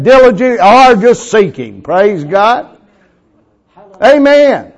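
loud, high, fervent preaching; full-force preaching. Acted. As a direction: preach it out to the congregation.

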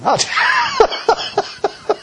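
knee-slapping laugh; big laugh. Acted. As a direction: burst out in a huge hearty laugh. Acting God laughing.

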